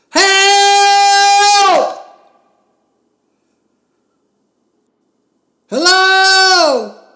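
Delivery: very high, very loud cries for help; desperate screaming. Acted. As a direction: scream for help with everything you have. Still alone, screaming again.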